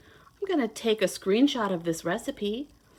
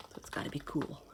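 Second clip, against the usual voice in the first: soft whispered aside; 8 semitones lower, 12 dB quieter, faster than usual. Acted. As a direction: lean in and whisper it to the kids.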